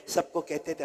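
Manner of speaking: ordinary talk, nothing marked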